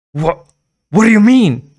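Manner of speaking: high, loud stammering shock. Acted. Girl stammering at the store owner in shock. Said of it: "Carly Beth stammered".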